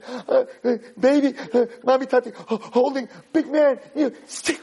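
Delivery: gasping, high-pitched broken words; hysterical sobbing child. Acted. Boy telling mommy what he saw, hysterical. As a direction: stammer through mock sobs. Terrified boy stammers to mother.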